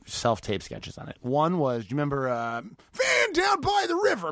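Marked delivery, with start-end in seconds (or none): 2.6-4.3 high booming motivational shout; Matt Foley shout. Retold or imitated. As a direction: bellow it like Matt Foley, slowed down. Quoting Matt Foley sketch.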